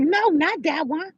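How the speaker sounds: a touch of mock anger, drawn out; mock irritated girl. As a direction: imitate an annoyed young woman, hammed a little. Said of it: "She was like".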